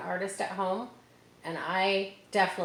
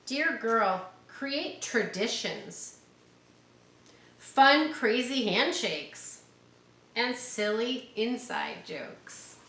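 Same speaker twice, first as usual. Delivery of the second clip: bright, slow picture-book read; reading to children; 5 semitones higher, 4 dB louder, much slower than usual. Acted. Teacher reading the book to her class, bright and slow.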